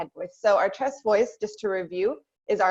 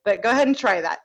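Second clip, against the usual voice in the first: ordinary talk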